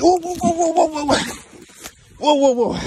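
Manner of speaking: high, rapid-fire alarm. Real, not acted. Man holding struggling emu, real alarm. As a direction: try to halt a struggling animal.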